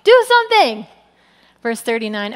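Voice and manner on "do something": high, loud panicked plea; frightened fishermen crying out to Jesus. Retold or imitated. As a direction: yell for help as the boat sinks. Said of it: "They're like".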